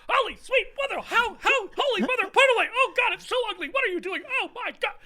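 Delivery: very high, loud, squeaky horrified exclamations; imitating an actor losing his composure in a shriek; flustered shocked outburst. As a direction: squeal in horror at the ugly painting. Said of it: "And then turns around".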